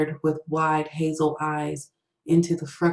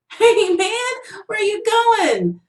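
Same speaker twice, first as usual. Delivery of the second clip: much higher, louder, drawn-out whine; almost a whine; 7 dB louder, slower than usual. A narrator's reading. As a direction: plead after him in a high whiny call. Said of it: "Kyle's voice was almost a whine".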